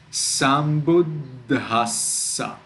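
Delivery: Sambuddhassa very slow, dd stopped and ss hissed long; stuck at D and S. Shown as a demonstration. Teacher demonstrating for learners, Sambuddhassa held at both doubles. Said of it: "stuck at the D and stuck at the S".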